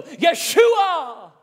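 Yeshua shouted high and loud in scorn; a jeering mob yelling Yeshua at fleeing David; mocking yell. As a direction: hurl the name like a taunting crowd. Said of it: "they're mocking him".

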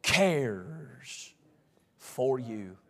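sudden drop to low, soft words; slow, hushed and intimate after the big build; tender hush. Acted. Preacher telling congregation God cares, tender hush.

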